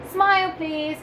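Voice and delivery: high, loud cheery request; photographer's call. A narrator's reading. Reading to children, voicing the wedding photographer cheerily. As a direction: coax the wedding party to smile.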